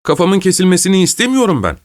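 high-pitched plea from a prisoner; acted, insistent pleading; pleading protest. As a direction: protest against your fate. Condemned prisoner protests his execution.

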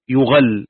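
"يغل" as yughall, u on ي and a on غ; damma then fatha. Shown as a demonstration. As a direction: say "يغل" in the passive form, yu-ghall. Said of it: "بضم الياء وفتح الغين". ("يغل" بضم الياء وفتح الغين)

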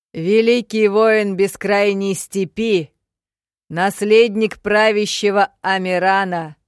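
loud, slow warrior's bellow; savage roar. A narrator's reading. Barbarian declares his titles before the duel, roaring. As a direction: roar out each title proudly, stretched out. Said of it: "проревел дикарь".

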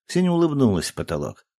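ordinary delivery

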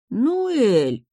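high-pitched little-brother whine; pleading, complaining kid voice; whining. A narrator's reading. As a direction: whine her name in protest. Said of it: "законючил младший".

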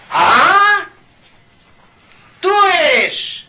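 high, loud accusing cry; sudden furious outburst, high and loud; angry accusation. Acted. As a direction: confront the masked stranger, furious. Man confronts masked stranger.

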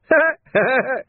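high mocking laugh; nasty thug's laugh; villain's cackle. A narrator's reading. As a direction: cackle at Vimes. Carcer laughs.

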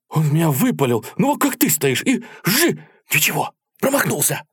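very high, animated retelling; excited war tale. A narrator's reading. Soldier telling comrades he was missed, excited. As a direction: act out the shot in a high voice. Soldier acting out being shot at.

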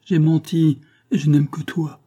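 plus aigu et plus fort, un appel passionné; crie-lui de revenir de toute ton âme; cri du cœur. A narrator's reading. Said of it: "elle lui criait de toutes les forces". (cri intérieur passionné, plus haut et plus fort)

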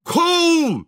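high-pitched yell to drive a horse; shout at a horse. A narrator's reading. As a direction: holler to get the horse moving. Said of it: "закричал Шаринов на лошадь". (крик на лошадь)

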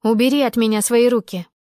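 loud, forceful demand; angry shout. Acted. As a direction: shout at him to let go. Heroine fights him off.

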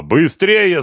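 high, loud cry to hurry; urgent bellow; full-force yell. A narrator's reading. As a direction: yell with all your might. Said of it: "заорал он изо всех сил".

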